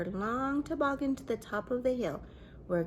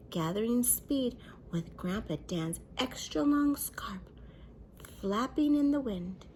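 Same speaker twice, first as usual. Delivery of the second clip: ordinary delivery, nothing marked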